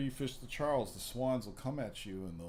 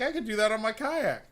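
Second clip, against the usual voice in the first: excited exclamation; 11 semitones higher, 9 dB louder than usual. Retold or imitated. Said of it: "I'm like".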